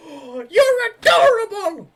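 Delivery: very high, loud, slow gushing squeal; squealing delight. Acted. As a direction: coo at the pet, high-pitched. NPC coos at the animal.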